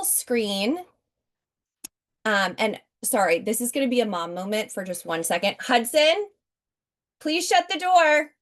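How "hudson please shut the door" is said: calling out to son. Real, not acted. Mom on a sales call calling out to her son, raised voice. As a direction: raise your voice to the next room.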